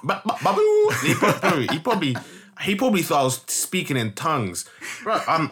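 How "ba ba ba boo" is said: high, loud mock babbling; mock babble. Mocking how the kid heard his speech, squealed babble. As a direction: squeal nonsense syllables, hammed up.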